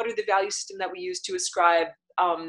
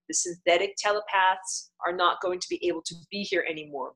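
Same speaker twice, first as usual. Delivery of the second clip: ordinary talk, nothing marked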